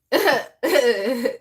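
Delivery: loud, high laugh; real laughter. Real, not acted. Streamer reacting to what viewers will say, laughing hard. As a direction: burst out laughing at the joke.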